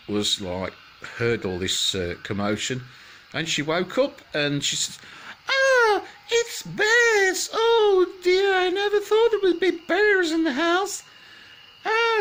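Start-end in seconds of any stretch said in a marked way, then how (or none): 5.5-12.2 very high, loud panicked scream of words; a terrified girl waking to bears; screaming in fright. Acted. Goldilocks wakes and screams at the bears, very high panicked cry. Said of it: "screamed and screamed".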